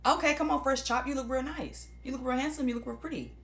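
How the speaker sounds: bubbly friend raving over a new look; gushing compliments. Retold or imitated. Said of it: "a real motherfucker".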